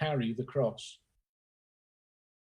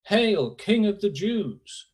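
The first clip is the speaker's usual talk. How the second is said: slower, louder, higher mock salute; mocking hail; 6 semitones higher, 6 dB louder, slower than usual. A narrator's reading. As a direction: call it out as a sneering salute. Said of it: "They began to call out to him".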